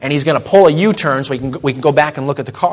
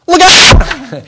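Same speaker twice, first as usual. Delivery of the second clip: sudden high yell; hollered warning; 14 semitones higher, 10 dB louder than usual. Retold or imitated. Dad hollering at the driver, loud mock yell. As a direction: imitate dad yelling a warning. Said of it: "so he hollers".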